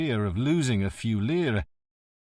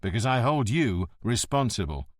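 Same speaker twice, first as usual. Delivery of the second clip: plain reading voice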